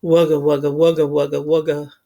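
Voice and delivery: low rumbling engine noise; engine grumble. A narrator's reading. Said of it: "It was saying".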